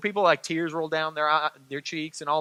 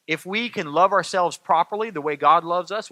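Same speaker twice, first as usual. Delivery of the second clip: normal talking voice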